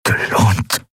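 breathy, slow croak on the verge of coughing; choked-out words. A narrator's reading. Prisoner getting out a last word before a coughing fit, choked. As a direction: force the words out with failing breath. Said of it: "bevor ihn ein Hustenkrampf schüttelte".

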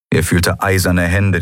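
plain reading voice

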